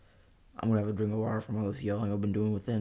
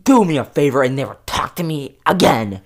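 loud, higher furious yell; furious shout; 4 semitones higher, 11 dB louder than usual. Acted. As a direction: scream the final word. Shouting match.

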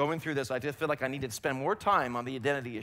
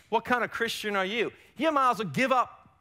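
high, raised-voice taunting put-down; preacher acting the discouraging enemy, pitched up; enemy's accusing voice; 4 semitones higher than usual. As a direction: belittle them like an accuser in their head. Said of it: "That's what the enemy will tell you".

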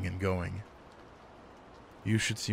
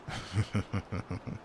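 soft chuckle; 5 dB quieter than usual. Real, not acted. Chuckling at own slowness.

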